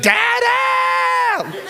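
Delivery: high loud drawn-out yell for daddy; calling dada. Retold or imitated. Daughter calling her father from upstairs, long high yell. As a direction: holler across the house like a duel. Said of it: "call me out by name, like a Wild West duel".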